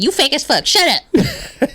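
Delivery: high-pitched mock telling-off; imitating Tierra. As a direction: mimic your friend squawking an insult. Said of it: "She be like".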